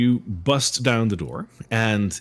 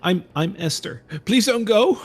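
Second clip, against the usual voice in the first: put-on high, pleading child's voice, louder on please don't go; scared little girl; 11 semitones higher, 6 dB louder than usual. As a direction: beg like a frightened small girl.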